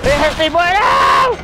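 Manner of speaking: high yell breaking into a loud no; panicked wail. Real, not acted. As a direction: cry out in panic at being hurt. Hurt by boss in fight.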